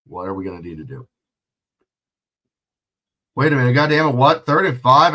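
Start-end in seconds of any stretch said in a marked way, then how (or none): 3.4-5.1 louder, sudden double take; surprised. Real, not acted. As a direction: catch the mistake on the scoreboard mid-sentence. Surprised by the down marker.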